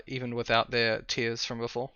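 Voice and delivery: ordinary talk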